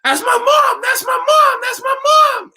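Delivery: high, loud yelling, repeated; excited yell. Retold or imitated. Student yelling out in the middle of class, high excited shouts. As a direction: shout it across a dark classroom. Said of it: "a young woman yells out".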